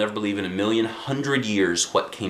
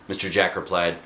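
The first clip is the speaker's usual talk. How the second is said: ordinary delivery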